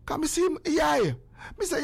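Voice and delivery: ordinary talk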